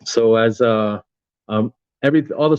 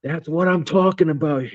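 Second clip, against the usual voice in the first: excited officer; 7 semitones higher than usual. Retold or imitated. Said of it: "he slams his hand down on the table and he goes".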